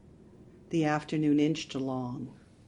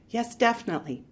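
firm; 6 semitones higher, 5 dB louder, faster than usual. Acted. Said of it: "Marianne said firmly".

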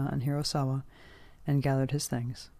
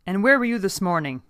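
loud, raised demand; barked question; 5 semitones higher, 7 dB louder than usual. A narrator's reading. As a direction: bark it out like an angry boss. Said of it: "he barked".

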